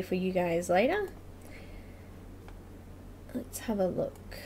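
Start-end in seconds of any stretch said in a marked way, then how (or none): 3.4-4.4 soft unvoiced mutter while busy; whispering to herself. Real, not acted. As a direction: whisper it under your breath.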